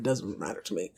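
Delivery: low aside. Real, not acted.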